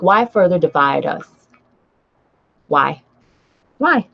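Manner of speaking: ordinary talk, nothing marked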